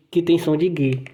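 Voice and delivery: ordinary talk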